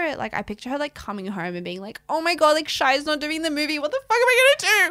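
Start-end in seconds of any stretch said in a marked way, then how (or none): 2.1-4.9 high, loud, rushed mock panic; acted panic. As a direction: play a frantic meltdown, building to a shriek. Voices her panicking at home.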